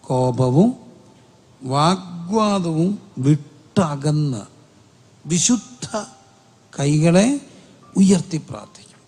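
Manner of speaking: slow, drawn-out preacher's reading; emphatic scripture reading. Real, not acted. Preacher reads the verse to the congregation, slowly and emphatically. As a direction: stretch the key words, pausing between phrases.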